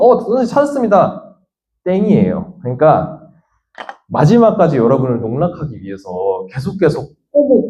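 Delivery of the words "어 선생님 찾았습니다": high-pitched pretend student announcing a find; acted eager discovery. Student telling teacher they found the answer, mock eager discovery. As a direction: play a pupil who thinks they have it.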